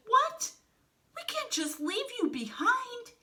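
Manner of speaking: very high-pitched, loud protest; distressed disbelief. A narrator's reading. Wolf protests leaving his friend behind, distressed. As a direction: protest in a high, upset squeal.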